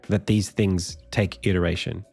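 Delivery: ordinary talk, nothing marked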